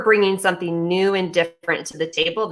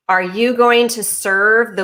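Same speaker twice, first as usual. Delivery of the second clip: ordinary delivery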